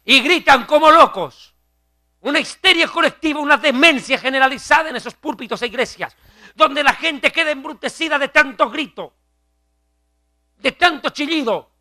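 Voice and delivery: high-pitched, heated denunciation; impassioned preaching. Real, not acted. Pastor decrying collective hysteria in churches, fervent preaching.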